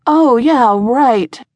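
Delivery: plain reading voice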